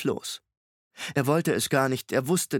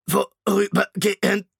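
laut, langsam, höher, leiernd wie ein kaputtes Tonband; leiernde Maschinenstimme; 7 semitones higher, 7 dB louder than usual. A narrator's reading. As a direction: zieh den Namen wie ein defektes Band. Shrikes Maschinenstimme. (Shrike spricht Hester an, leiernd und knackend wie ein Tonband)

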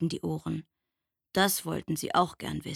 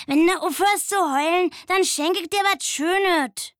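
much higher, louder know-it-all mole; 11 semitones higher, 7 dB louder than usual. A narrator's reading. Mole poking head from the earth, teasing in a high voice. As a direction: tease the squirrel as a cheeky mole. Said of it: "sagte der Maulwurf".

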